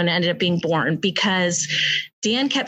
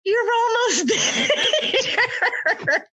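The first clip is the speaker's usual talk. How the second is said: laughing coach line; 13 semitones higher than usual. Retold or imitated. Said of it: "he's like".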